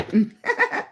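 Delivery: high, quick burst of laughter; short laugh. Real, not acted. Reader laughing at someone too scared to do it. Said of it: "I shouldn't laugh".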